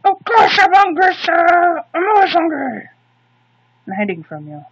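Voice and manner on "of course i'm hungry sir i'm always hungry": high, loud cartoon fish voice; shouted hungry fish voice.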